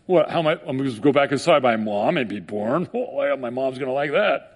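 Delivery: a touch of scoffing disbelief, lightly voiced; mocking Nicodemus. Nicodemus mocking Jesus's words, mild sneer. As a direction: sneer at the idea of going back inside his mom. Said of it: "he's mocking it".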